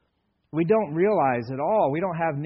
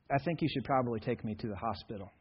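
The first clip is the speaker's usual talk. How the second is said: softer, flat, matter-of-fact; calm understatement; 4 semitones lower, 7 dB quieter than usual. Retold or imitated. Dad with a sawn finger telling mom, calmly soft and flat. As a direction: say it like nothing is wrong. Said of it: "in that tone of voice".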